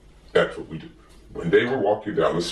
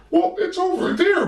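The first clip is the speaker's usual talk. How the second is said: much higher, wondering bird voice; high Big Bird voice; 3 dB louder than usual. Acted. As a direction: ask Gordon in a high, eager bird voice. Big Bird answers Gordon.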